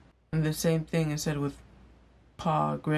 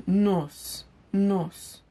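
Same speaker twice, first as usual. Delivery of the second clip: higher-pitched Igbo-accented "nurse", with no R, like "nos"; Nigerian Pidgin accent; 4 semitones higher, 3 dB louder than usual. Shown as a demonstration. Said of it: "saying it with the right accent".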